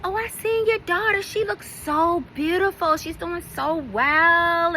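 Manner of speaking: mock sweet compliments from the acquaintance; imitating Treese gushing. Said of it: "And she's like".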